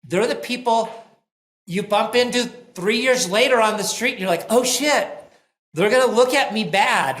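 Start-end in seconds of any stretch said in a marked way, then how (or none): 4.5-7.2 loud, higher-pitched mock alarm; mock dread. Boss bumping into someone they let go, mock dread. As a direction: act out an uh-oh moment. Acted dread at meeting ex-employee.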